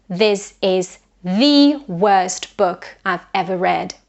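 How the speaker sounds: the stressed as a strong thee, slowly; slow, lean on the as thee; emphatic thee. Shown as a demonstration. Teacher modelling emphasis on the, slow and strong. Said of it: "give it special emphasis".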